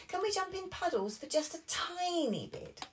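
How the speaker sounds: put-on high little-girl plea; high childish pleading voice; pleading Peppa. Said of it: "asked Peppa".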